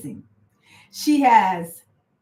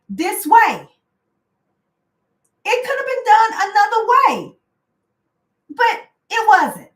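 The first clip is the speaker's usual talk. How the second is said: pitched way up and louder, indignant; heated outrage; 10 semitones higher, 5 dB louder than usual. Real, not acted. Venting to viewers about the firing, indignant and high. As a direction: let the unfairness burst out high.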